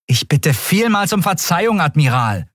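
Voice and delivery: high-pitched, acid mock apology; caustic sarcasm. A narrator's reading. Prisoner begging the admiral's pardon, caustic. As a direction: feign apology with biting scorn. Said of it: "Endriels Ton war ätzend wie Säure".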